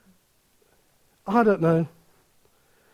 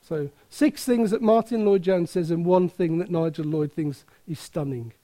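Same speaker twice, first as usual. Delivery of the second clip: normal talking voice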